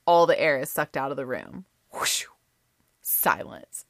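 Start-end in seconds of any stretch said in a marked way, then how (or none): 1.9-2.2 airy unvoiced whoosh. Storyteller showing the stunned room, whooshing noise. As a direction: imitate air sucked out of a room. Imitates air leaving the room.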